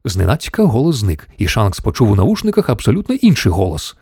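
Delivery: plain reading voice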